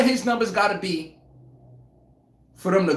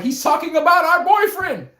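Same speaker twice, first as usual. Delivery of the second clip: mocking fans; 9 semitones higher, 4 dB louder than usual. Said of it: "They be like".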